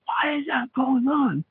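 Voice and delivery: very high, thin scream held back in volume; mock scream. Storyteller acting out the gunman's response to floating bullets, strangled mock scream. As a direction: imitate screaming without the loudness. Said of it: "was screaming".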